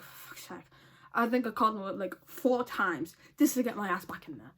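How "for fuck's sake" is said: breathy whispered for fuck's sake; exasperated whisper to herself; whispered curse. Retold or imitated. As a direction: whisper it under your breath in frustration. Muttered reaction to being put off.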